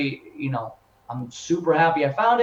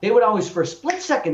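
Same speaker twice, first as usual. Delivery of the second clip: ordinary talk, nothing marked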